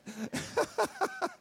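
brief high-pitched laugh at himself; high self-conscious laugh. Real, not acted. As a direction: laugh off being over the top. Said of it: "I can see I'm a little over top".

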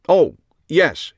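suddenly remember what you are meant to say; host's bright jolt of recall. A narrator's reading. Host suddenly remembers his cards.

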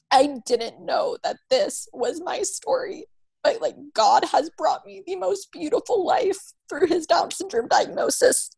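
a mother moved to tears by her story; crying. Real, not acted. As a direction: let the tears come as you speak. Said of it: "that I will always cry".

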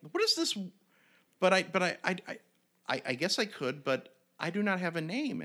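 high-pitched goblin, earnestly grateful; timid high goblin voice thanking the kids. Retold or imitated. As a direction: squeak it up high like a little goblin having a change of heart. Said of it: "And the goblin is like".

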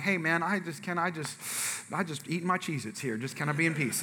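acted timid mumble to a stranger; meek plea. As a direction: plead quietly, backing out of it. Acts out meek plea to be left.

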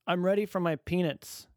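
put-on high, loud proud toddler; little boy declaring triumphantly; announcing child voice. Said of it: "he announced".

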